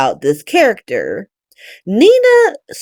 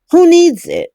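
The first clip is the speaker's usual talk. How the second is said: higher and louder, fed-up repeat; exasperated; 8 semitones higher, 4 dB louder than usual. Real, not acted. Recapping a soap, fed up with the plot, exasperated. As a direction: throw it out like an exasperated dismissal.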